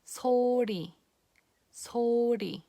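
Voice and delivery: "소리" with a light flap of the tongue tip on the roof of the mouth; soft, careful "소리"; Korean ㄹ in mid-word. Shown as a demonstration. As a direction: flap the ㄹ gently in "소리". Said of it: "a very gentle and soft touch".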